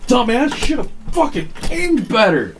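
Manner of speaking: a very high yelp that drops into a scolding; mock wrestler reaction. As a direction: cry out high, then mock the opponent. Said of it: "you go".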